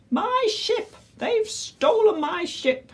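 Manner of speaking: very high, wailing mock sob; sobbing pirate. A narrator's reading. Pirate captain tells Flynn his ship is stolen, wailing sob. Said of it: "he sobbed".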